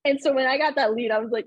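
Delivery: usual speaking voice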